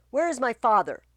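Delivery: loud demand; furious Laertes. Acted. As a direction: demand his father.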